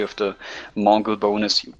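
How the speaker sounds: ordinary talk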